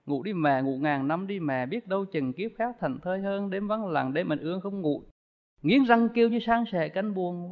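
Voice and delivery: soft, grieving song recited softly; mournful poem recital. Retold or imitated. Monk recites the song on losing mother, soft lament. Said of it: "trong cái bài ca mất mẹ đó".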